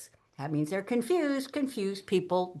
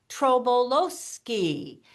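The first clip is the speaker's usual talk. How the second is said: TROBOLOWSKI very slow and musical, each syllable distinct; syllables pronounced; 3 semitones higher, much slower than usual. Shown as a demonstration. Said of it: "you actually pronounce the syllables".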